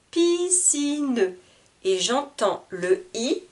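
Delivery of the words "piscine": "Piscine" high, in long beats Pi-sci-ne; split into syllables. Shown as a demonstration. Teacher repeating three syllables, "Piscine" drawn out. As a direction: voice the last e of "Piscine". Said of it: "Il y a trois syllabes".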